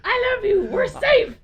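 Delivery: high, loud laughing declaration; mock aggressive yell. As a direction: yell love at the child, hammed-up. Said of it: "You aggressively yell".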